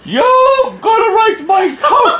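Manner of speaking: high, slow shout breaking into laughter; mock angry shout. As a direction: rant while laughing. Mock irate customer rant.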